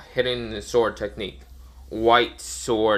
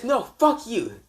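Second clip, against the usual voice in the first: high-pitched defiant yell; angry refusal; 12 semitones higher, slower than usual. Retold or imitated. Dragon refusing to pay Naruto, angry yell.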